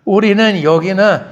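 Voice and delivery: ordinary delivery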